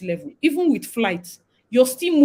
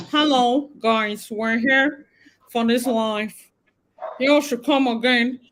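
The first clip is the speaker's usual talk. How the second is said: hammed-up Canadian accent, vowels distorted; put-on Canadian accent; 2 semitones higher, slower than usual. Shown as a demonstration. Vlogger welcoming viewers to a live, mock Canadian accent. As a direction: fake a Canadian accent for a greeting. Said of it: "my accent".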